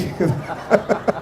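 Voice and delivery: breathy chuckle after the joke; soft laugh. Real, not acted. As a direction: let a quiet laugh slip out. (ketawa kecil)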